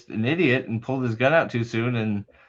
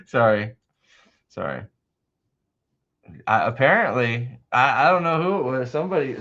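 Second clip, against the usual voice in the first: ordinary talk, nothing marked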